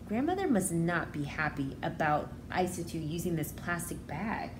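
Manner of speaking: ordinary delivery, nothing marked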